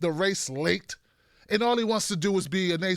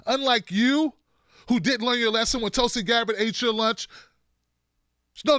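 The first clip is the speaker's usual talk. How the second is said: usual speaking voice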